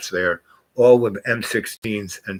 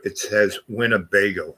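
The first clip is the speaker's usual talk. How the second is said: usual speaking voice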